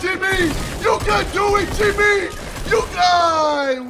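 high, loud cheering-on; shouted encouragement. Real, not acted. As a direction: yell to spur a teammate on. Urging teammate Jimmy on.

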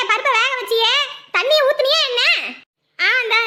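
put-on high, loud, nagging older woman; nagging mother-in-law. Mother-in-law scolding daughter-in-law over the burning stove, high and loud. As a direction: scold her about the burning pot.

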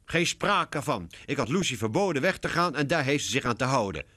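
high, indignant scolding; fuming mother. A narrator's reading. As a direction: forbid it crossly. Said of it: "briest Laura".

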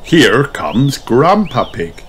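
ordinary delivery

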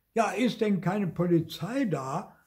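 high, shrieking call for the police; shrieking milkwoman. A narrator's reading. Milkwoman in the crowd calls for police, shrieking high. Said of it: "kreischte eine dicke Milchfrau".

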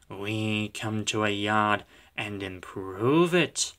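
slow, soft, self-important engine voice; hurt, haughty diesel. A narrator's reading. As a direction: draw it out quietly, smug and offended. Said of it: "Diesel looked hurt".

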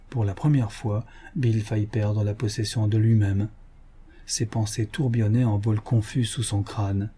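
ordinary delivery, nothing marked

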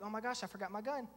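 soft, under-the-breath aside; hushed realisation. Retold or imitated. Husband alone outside the bedroom, retold hushed realisation. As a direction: say it quietly to yourself.

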